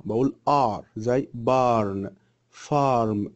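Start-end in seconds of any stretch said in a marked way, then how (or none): 0.5-0.8 "R" said as a thick, back "ar"; thickened AR sound. Shown as a demonstration. Teacher modelling the AR sound for pupils, thick vowel. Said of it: "لازم تتخن شوية". 1.3-3.3 "barn", "farm" slow, with a heavy back A; thick AR vowel. Shown as a demonstration. Teacher giving example words for AR, slow thick vowel. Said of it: "لازم تتخن شوية".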